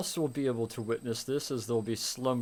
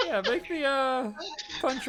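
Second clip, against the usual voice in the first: laughing; 13 semitones higher than usual. Real, not acted. Still laughing after the joke.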